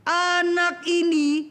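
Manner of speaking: angry mother scolding: Anak ini; harsh scolding tone. Shown as a demonstration. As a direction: bite out the words like an angry mother. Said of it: "ibu sih pakai suara nada keras".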